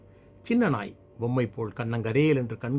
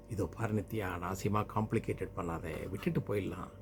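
lower, hushed husbandly reasoning; low-voiced dismissal; 4 semitones lower, 9 dB quieter than usual. Husband urging his wife to leave the dog, low quiet dismissal. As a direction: keep it low and soft, closing the matter.

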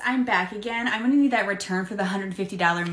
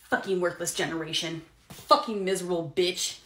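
ordinary delivery

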